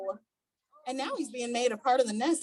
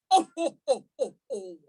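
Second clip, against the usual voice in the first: chuckle. A narrator's reading. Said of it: "starts as a chuckle".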